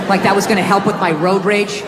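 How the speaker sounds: normal talking voice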